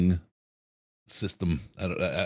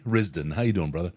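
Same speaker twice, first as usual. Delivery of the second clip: ordinary talk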